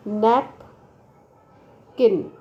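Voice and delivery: Napkin very slow, नैप, long pause, किन; syllables far apart. Shown as a demonstration. Teacher giving syllable examples, napkin split in two. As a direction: break napkin widely after नैप. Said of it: "which has two syllable".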